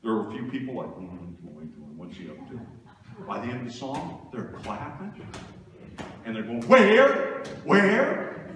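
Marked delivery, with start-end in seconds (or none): 0.9-2.6 soft, lower, doubtful mutter of curious onlookers; suspicious murmur. Retold or imitated. Curious people in the pew. 6.6-8.5 loud, high, excited call; eager calling. Retold or imitated. People eager to find God.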